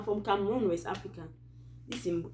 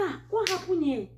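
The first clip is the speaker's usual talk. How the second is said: surprised; 12 semitones higher than usual. Retold or imitated. Wife finds parents gathered at home.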